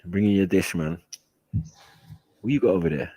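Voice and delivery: usual speaking voice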